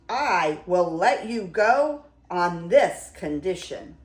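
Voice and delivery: loud, emphatic storybook beast; commanding beast. A narrator's reading. Beast sets a condition for the merchant, commanding. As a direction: lay down terms loudly and firmly.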